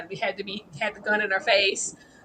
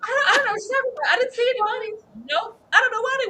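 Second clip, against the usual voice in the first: high, fast, shrill pretend denial; mock innocent Tom; 13 semitones higher, 4 dB louder, faster than usual. Host mocking Tom pretending he saw no money. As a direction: play dumb about the money. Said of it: "He's like".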